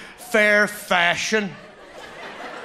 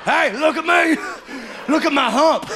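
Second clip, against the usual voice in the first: mock fairgoer; 6 semitones higher, 3 dB louder than usual. Mocking a fairgoer with a hump.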